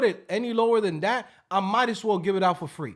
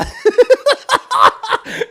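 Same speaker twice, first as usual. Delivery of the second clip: big laugh; 11 dB louder than usual. Real, not acted. Laughing at own joke.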